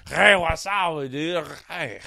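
put-on sloppy drunk party-guy hello; drunk self-impression. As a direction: play yourself drunk, stretching the words. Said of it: "impressions of myself while I'm drunk".

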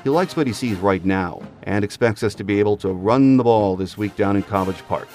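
normal talking voice

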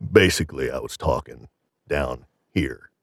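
showing off his lowest speaking register; very low voice demo. Shown as a demonstration. Recalling a meat market ad, very low voice. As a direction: drop way down low for the ad voice. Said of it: "as low as I could speak".

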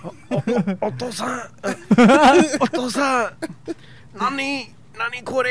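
high, giggly mock baby voice in Japanese; baby talking Japanese. Baby looking up at its father, high mock baby voice. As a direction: pitch up into a cartoon baby speaking Japanese. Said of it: "That baby would've looked at me like".

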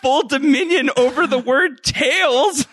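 laughing protest. Real, not acted.

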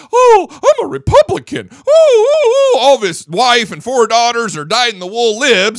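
high, loud sneering mimic of a smug man; shrill up the pitch and mock his self-righteous boast; hammed-up mockery of a pompous politician; mocking Comey voice.

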